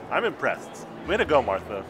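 ordinary talk

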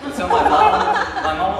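can't get through it for laughing; laughing while telling the cinnamon story. Real, not acted.

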